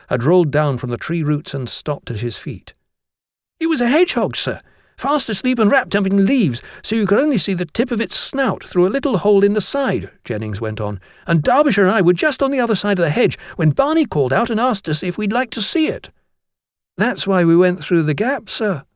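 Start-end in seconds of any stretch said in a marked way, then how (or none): 3.6-10.1 high, eager schoolboy voice; schoolboy Jennings. A narrator's reading. Boy character Jennings explaining. 11.2-16.1 high, eager schoolboy voice; schoolboy Jennings. A narrator's reading. Boy character Jennings continues. 17.0-18.8 higher-pitched schoolboy voice; schoolboy Derbyshire confirming. A narrator's reading. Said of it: "Derbyshire confirmed".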